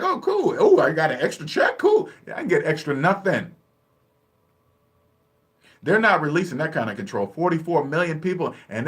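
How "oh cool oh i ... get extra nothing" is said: naive younger self. Retold or imitated. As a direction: pitch it up like a clueless kid with a check. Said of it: "I was like".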